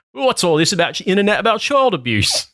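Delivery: high-pitched mock voter on the doorstep; put-on voice. Voter confronting the canvasser on the doorstep, in a high put-on voice. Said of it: "I had to say it in that voice".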